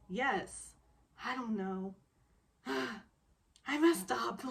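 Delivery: slow, sighed "I messed up"; mock-dejected confession; sighing admission. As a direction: let the breath out, defeated. Quoting his resigned admission.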